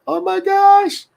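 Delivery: very high, slow, gushing oh my gosh; extremely high melting gasp; awed squeal. Retold or imitated. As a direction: swoon over the grandchild, stretched out. Retelling reaction again.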